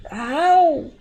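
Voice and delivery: short cry of cramp pain; pained yelp. Real, not acted. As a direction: yelp as it hits again. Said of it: "I got a foot cramp".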